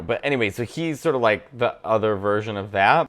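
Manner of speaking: normal talking voice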